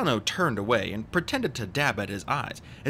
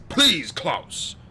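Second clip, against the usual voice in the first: loud, raised reproof; firm, higher-pitched admonishment; admonishing; 6 semitones higher, 6 dB louder than usual. A narrator's reading. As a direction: scold the boy to hush. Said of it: "Mister Poe admonished".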